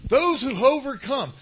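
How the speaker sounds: loud, high scripture call; preacher leading a call and response; call to repeat. Shown as a demonstration. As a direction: proclaim it for the room to echo. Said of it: "Somebody say".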